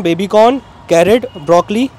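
ordinary talk

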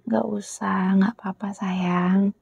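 soft whisper, letting it go; reassuring whisper. Acted. Girlfriend telling boyfriend he need not block his ex, soft whisper. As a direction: whisper it's fine, gently.

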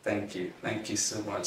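ordinary talk

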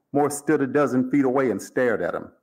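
ordinary delivery

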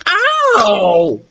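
high yelp of pain; howl of pain. A narrator's reading. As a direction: howl as if an ear is pulled. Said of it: "he howled".

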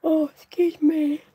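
high, slow yawn through the words. Real, not acted. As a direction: talk while yawning. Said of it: "for yawning".

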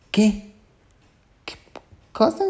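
high-pitched, halting disbelief; shocked. A narrator's reading. Bia hears that Ana is her sister, shocked. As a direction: jump up high in stunned questions. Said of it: "Y Bia en shock".

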